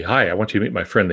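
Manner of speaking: ordinary talk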